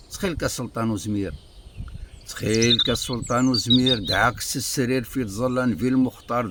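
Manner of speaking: measured, projected recital of poetry; poem recitation. Acted. Reciting a poet's verses before explaining their words, declaimed.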